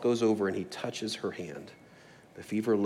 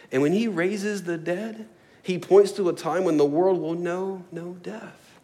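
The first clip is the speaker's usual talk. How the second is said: raised pitch, measured preacher's cadence; rhythmic preaching; 8 semitones higher, 4 dB louder than usual. Real, not acted. Preacher on hungry, sick and dead, rhythmic preaching. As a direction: build a refrain, lifting and stretching each opening clause.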